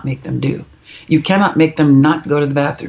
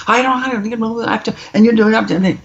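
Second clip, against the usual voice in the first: put-on higher, fast whiny kid protesting; raging child; 6 semitones higher than usual. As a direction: mimic a kid mid-tantrum, rushed and high. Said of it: "when they finish their little rage".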